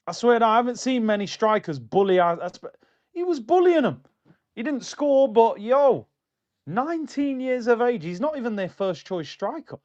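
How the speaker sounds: high loud hyped-up raving; worked-up fan rant; excited rant. Real, not acted. Raving about the young striker.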